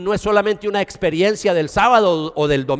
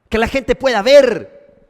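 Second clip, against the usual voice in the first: ordinary talk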